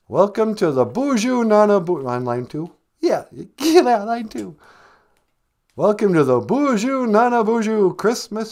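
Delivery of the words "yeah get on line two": ordinary talk, nothing marked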